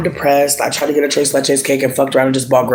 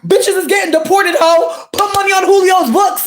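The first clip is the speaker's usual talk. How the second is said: mock retort; 4 dB louder, slower than usual. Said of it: "They said".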